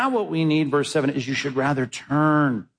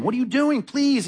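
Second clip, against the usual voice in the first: pleading father; 8 semitones higher than usual. Acted. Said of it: "his father came out and begged him".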